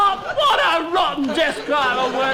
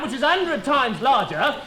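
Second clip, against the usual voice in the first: ordinary talk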